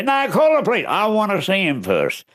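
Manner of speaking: very high, quick back and forth; husband and wife squabble over seeing the burglar; bickering couple. Retold or imitated. Spouses bickering.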